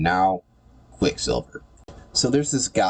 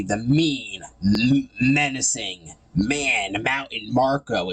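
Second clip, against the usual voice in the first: slow, higher, stretched-out villain billing; mock-menacing build-up; 4 semitones higher, much slower than usual. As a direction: draw out each word with sarcastic drama.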